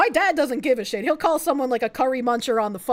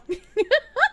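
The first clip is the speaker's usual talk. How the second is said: squealing laugh, much higher; giggling at her own joke; high burst of laughter; 9 semitones higher than usual. Real, not acted.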